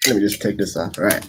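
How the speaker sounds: ordinary talk, nothing marked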